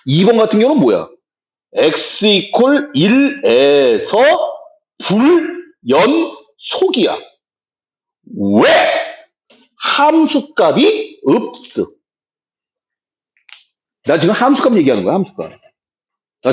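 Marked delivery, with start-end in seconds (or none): 1.7-7.2 very slow, spaced-out words; slow emphatic dictation. Shown as a demonstration. Teacher spells out the answer for class, very slow. 9.8-11.8 high, drawn-out words; slow emphatic recitation. Shown as a demonstration. Teacher gives students the reason, high and slow.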